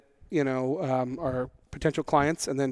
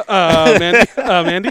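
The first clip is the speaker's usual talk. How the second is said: loud, higher "Uh, Mandy?" with a laugh; incredulous echo; 3 semitones higher, 11 dB louder than usual. Retold or imitated. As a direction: throw the accusation back, loud and questioning. Incredulously echoes the teasing quote.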